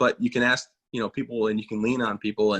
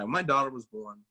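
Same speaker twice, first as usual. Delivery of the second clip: ordinary delivery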